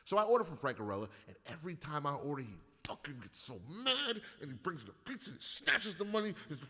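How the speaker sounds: usual speaking voice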